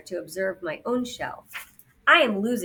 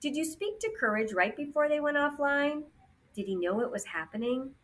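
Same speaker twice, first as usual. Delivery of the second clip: soft, slightly raised questioning voice; gently steer back to the task with a question; mildly concerned robot inquiry; Resilience asking; 7 semitones higher, 4 dB quieter than usual. A narrator's reading. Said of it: "trying to move the topic back".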